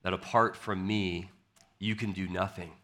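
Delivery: soft, hushed pastoral prayer. Acted. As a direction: pray quietly before the sermon. Opens with a prayer.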